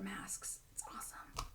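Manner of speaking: whispered aside; soft whispered plug; whispering. Real, not acted. Aside plug for the mask makers.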